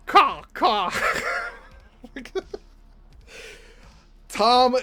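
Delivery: normal talking voice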